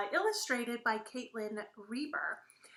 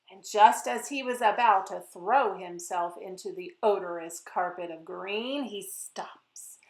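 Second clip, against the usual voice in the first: put-on lively, sing-song narration for kids; storybook read-aloud; 7 dB louder than usual. A narrator's reading. Author reading her book to children, lively and playful. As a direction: read it like story time for little ones.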